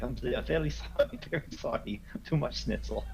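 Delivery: put-on apologetic Russian-accented passenger; embarrassed apology in a put-on Russian accent. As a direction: excuse yourself sheepishly with a Russian accent. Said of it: "like, a Russian accent".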